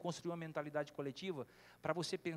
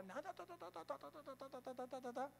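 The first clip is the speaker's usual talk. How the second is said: put-on high, fading chatter of a child cutting in; mimicking a son talking over his dad; child's chatter; 8 semitones higher, 6 dB quieter than usual. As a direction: rattle it off higher and softer like a kid interrupting.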